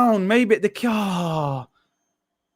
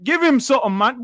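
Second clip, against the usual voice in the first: usual speaking voice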